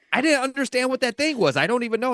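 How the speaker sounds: very high, squeaky defensive whine; a friend caught out in the game, in a very high, flustered voice; mock flustered friend.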